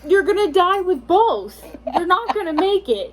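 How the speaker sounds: big incredulous reaction; loud exclamation. Real, not acted. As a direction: call it out emphatically. Exclaims both choices are deadly.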